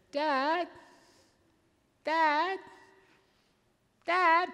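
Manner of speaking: put-on high-pitched little child nagging; five-year-old daughter. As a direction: call for a parent over and over, high and insistent. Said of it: "my five-year-old daughter walks up".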